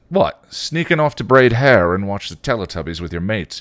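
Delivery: teasing, punched-up retort; mocking retort. A narrator's reading. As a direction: jeer lightly at a little sister. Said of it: "he retorted".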